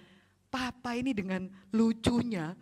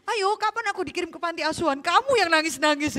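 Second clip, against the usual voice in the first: put-on fast, higher teasing child voice; cheeky child taunt; 7 semitones higher, 7 dB louder than usual. Child mocking mother's threat, cheeky fast taunt. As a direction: dare the parent to follow through. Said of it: "Anakmu seneng-seneng".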